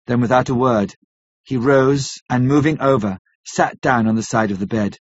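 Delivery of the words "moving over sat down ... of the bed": ordinary delivery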